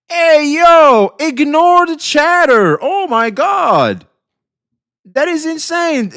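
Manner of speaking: loud, high, drawn-out amazement; real excitement. Real, not acted. Streamer reacting to a viewer's gift subs, loud drawn-out amazement. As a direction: call out stunned thanks, stretching the words.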